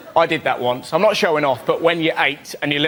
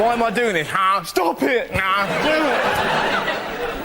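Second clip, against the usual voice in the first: higher, bickering with himself; self-argument mid-leap, higher, final line slow; mock inner argument; 4 semitones higher, much slower than usual. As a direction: argue with yourself, then drag out the last line.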